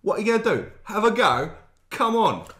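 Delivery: challenging Geordie accent; put-on Geordie taunt. Shown as a demonstration. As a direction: egg someone on in a Geordie accent. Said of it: "you sound like Geordies".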